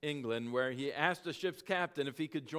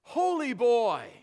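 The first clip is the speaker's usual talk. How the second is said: higher, louder, slow call from afar; distant calling voices; 8 semitones higher, 10 dB louder, slower than usual. Retold or imitated. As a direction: call out across a dream. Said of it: "Irish voices calling him".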